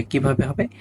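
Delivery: usual speaking voice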